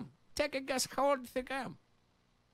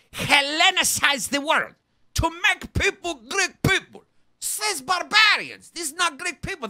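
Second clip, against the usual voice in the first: bombastic rant; 3 semitones higher, 5 dB louder than usual. Acted. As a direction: preach it like a fanatic. Loud nationalist rant.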